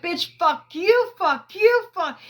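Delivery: high, loud repeated curses, hammed up; imitated defiant shouting. Imitating the cousin cursing at the family in court, high and loud. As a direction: fire each one off at a different person. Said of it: "He was like".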